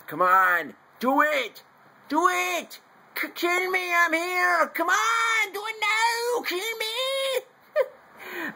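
put-on very high, strained Austrian-accented yelling; Arnold Schwarzenegger impression. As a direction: scream it as a hammed-up action-movie hero with an Austrian accent. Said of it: "I can't do an Arnold Schwarzenegger Austrian voice".

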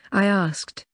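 ordinary delivery, nothing marked

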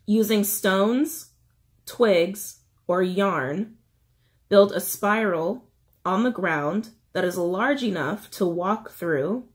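slow, with pauses between items; slow reading. Shown as a demonstration. Reiterating instructions for anyone struggling to shed, slow reading. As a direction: read it out slowly, phrase by phrase. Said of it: "I'll try to read it slowly".

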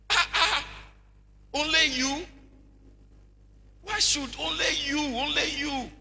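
loud, high, mock offended retort; mock indignant. Person offended at the question, retorting loud and high. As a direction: play the offended person demanding why only them.

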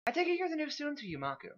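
high-pitched, well-mannered character voice; refined, high voice; formal lady. Acted. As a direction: inquire with polite formality. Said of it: "the formality of hers".

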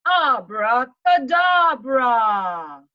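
loud, slow, drawn-out Abracadabra; shouted spell. Acted. Winnie shouting her spell to bring back winter. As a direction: bellow the magic word. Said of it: "And she shouts".

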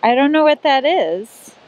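usual speaking voice

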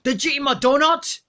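usual speaking voice

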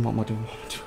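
fading mutter. Real, not acted. Repeats, fading away.

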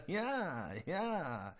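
short laugh in another voice; mocking laugh. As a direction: laugh it off at the old man. Gennaro lacht über Hammond. (spöttisches Lachen)

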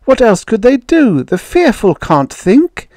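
high, anguished outburst; impassioned high-pitched protest; shouting. A narrator's reading. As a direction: shout it as a desperate plea and accusation. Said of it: "she shouted".

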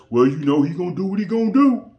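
loud, rising, hurried put-on voice; mock excuse-making. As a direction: mimic someone brushing it off, loud and fast. Mimics people excusing others' behaviour.

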